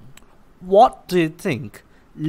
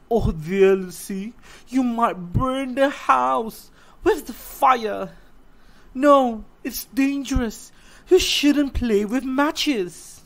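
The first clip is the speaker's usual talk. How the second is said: a higher, gently pleading mother's voice; lightly voiced mother; 8 semitones higher, 4 dB louder than usual. Mother warning little Lucy off matches, gently higher. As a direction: warn your little girl kindly, a bit higher.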